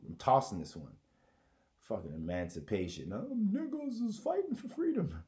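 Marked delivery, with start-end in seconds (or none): 3.1-5.2 mock activist voice. Mocking activists in high voice.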